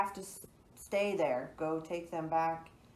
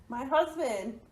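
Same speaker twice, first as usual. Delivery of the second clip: urgent cry; 8 semitones higher, 7 dB louder than usual. Acted. Wife greets husband after murder.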